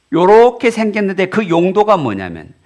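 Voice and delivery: ordinary talk, nothing marked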